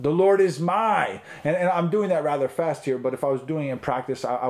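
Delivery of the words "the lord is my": my drawn out, high and loud; stress on my. Shown as a demonstration. Teacher showing how to meditate on a psalm, my emphasized. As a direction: push slowly up onto the word my. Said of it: "while emphasizing, uh, a different word".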